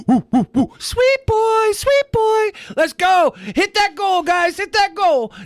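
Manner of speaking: very high falsetto hype, mocking; mock hype streamer. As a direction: squeal like an overexcited streamer begging for donations.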